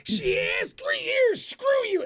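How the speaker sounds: very high, loud, slow screeched insult; mock insulting gamer. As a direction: shriek the insult like a furious gamer.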